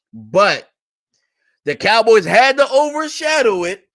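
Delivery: high, loud sarcastic cheering; mock hype. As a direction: hype it up like a fake celebration. Sarcastic hype over a re-signing.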